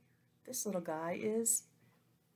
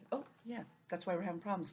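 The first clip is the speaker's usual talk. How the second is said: ordinary talk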